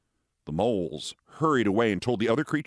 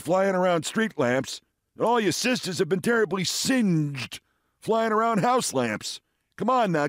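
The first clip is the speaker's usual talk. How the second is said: goading father moth; 6 semitones higher than usual. Acted. Father moth goading his unburned son, high and teasing. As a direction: egg the boy on, pitched up.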